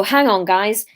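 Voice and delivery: ordinary delivery, nothing marked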